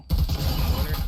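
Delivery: loud, sharp hype call; excited shout. Real, not acted. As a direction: call it like a big play.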